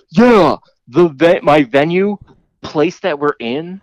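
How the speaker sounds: high, slow mocking voice; imitating her smug claim; mock snooty claim. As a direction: drag it out like a dim know-it-all.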